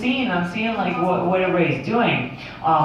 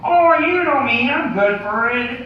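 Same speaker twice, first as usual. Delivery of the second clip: Kermit the Frog; 3 semitones higher than usual. Acted. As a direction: plead your case like a puppet frog. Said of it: "Kermit the Frog here".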